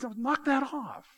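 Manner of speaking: mock scolding. Apostle telling a divided church off, in a high mock scold. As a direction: wave them off in a high, slow voice.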